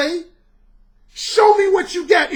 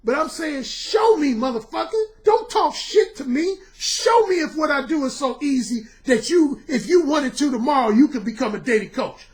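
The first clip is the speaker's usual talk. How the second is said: ordinary delivery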